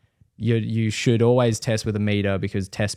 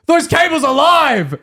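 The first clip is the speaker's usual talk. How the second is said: high, loud, slowed panicked yell; mimicked shriek of alarm; freaking-out shout; 6 dB louder, slower than usual. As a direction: scream an urgent warning. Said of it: "he's, like, full freaking out".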